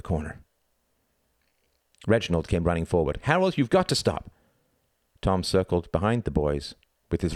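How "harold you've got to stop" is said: high-pitched urgent call; urgent plea. Acted. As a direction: beg him to stop, calling out. Boy pleading during a fight.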